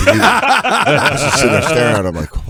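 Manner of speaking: usual speaking voice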